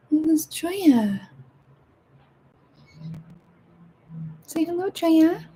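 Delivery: high, slow cooing pet voice; cat voice. Real, not acted. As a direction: coo it like talking to your cat. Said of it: "That's my cat voice".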